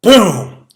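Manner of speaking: high, loud boom; booming storm sound. Acted. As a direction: shout the thunder. Storm sound effect in story.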